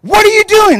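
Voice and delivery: high, loud shocked cry; shocked question. Retold or imitated. Pastor confronting a congregant, high and loud. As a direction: yelp it out in disbelief. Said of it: "I'm like".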